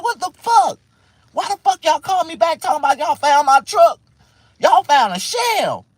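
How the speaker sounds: high, loud yell of disbelief; furious, incredulous retort; outraged yelling. Retold or imitated. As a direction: yell indignantly down the phone. Acts out his angry reaction.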